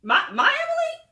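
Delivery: high, loud incredulous question; shocked disbelief. Retold or imitated. Malaysia hears it's her Emily, in shocked disbelief. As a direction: squeak it out in mock disbelief.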